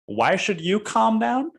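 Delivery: high, slow sarcastic mimicry of the question; sneering mock echo. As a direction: parrot his words back, mocking him. Mocking the salesman's question.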